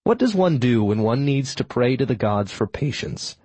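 usual speaking voice

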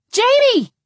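high, loud panicked scream of a name. A narrator's reading. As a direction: scream his name in terror. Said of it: "I screamed".

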